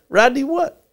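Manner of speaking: high cheerful grown-up; friendly director. Retold or imitated. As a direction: coax a shy kid. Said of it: "He goes".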